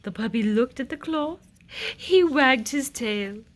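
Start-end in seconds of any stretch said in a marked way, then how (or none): none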